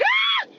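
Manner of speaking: sudden piercing high shriek; startled high scream. Shown as a demonstration. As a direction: let out a short shrill scream. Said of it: "Can we start screaming?".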